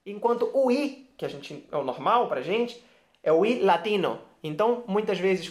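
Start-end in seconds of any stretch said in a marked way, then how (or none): none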